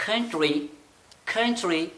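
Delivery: कंट्री with aspirated kh, like ख. Shown as a demonstration. Teacher demonstrating kh sound in कंट्री. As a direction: breathe out the k of कंट्री. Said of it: "इस क को ख़त्म करके ख पे आना है".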